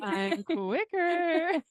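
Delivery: high, slow whiny brag; bratty kid voice. Retold or imitated. Imitating Lando's radio line.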